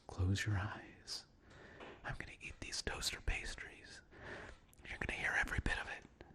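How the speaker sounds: soft breathy whisper right in your ear; ASMR whisper. Acted. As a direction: whisper close to the mic like ASMR. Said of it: "to give you an A S M R moment".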